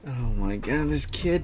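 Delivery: ordinary talk, nothing marked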